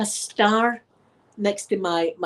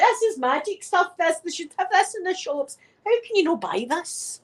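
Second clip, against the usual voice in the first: giddy delight; 9 semitones higher than usual. Retold or imitated. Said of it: "I was like".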